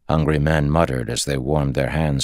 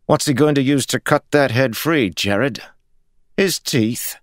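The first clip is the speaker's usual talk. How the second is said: higher, sneering jab; mock the lord openly; guard mocking his lord before the camp; mocking disrespect; 11 semitones higher, 4 dB louder than usual. A narrator's reading. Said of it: "Ares' voice bore little respect".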